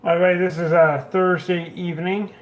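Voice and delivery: ordinary talk, nothing marked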